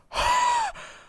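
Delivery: loud, high gasp; sharp intake of shock; startled gasp. Real, not acted. Streamer gasps at seeing their picture posted, startled.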